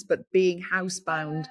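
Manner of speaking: ordinary talk, nothing marked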